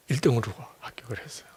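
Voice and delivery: 숨소리로 빠르게 속삭이듯; 놀라운 비밀을 털어놓듯 속삭여; 감격의 속삭임. Real, not acted. 감격의 소식을 밝히는 장면. (아들의 합격 소식을 전하며 빠른 속삭임)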